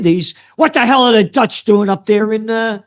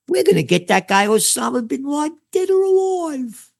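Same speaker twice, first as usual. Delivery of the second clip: mock Bush; 6 semitones higher, 3 dB quieter than usual. Mocking Bush quote.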